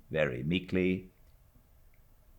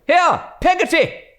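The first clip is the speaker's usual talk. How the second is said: loud, much higher call; 12 dB louder than usual. A narrator's reading. Stern aunt calling the servant, loud call. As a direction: call her down the passage. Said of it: "cried Miss Betsy".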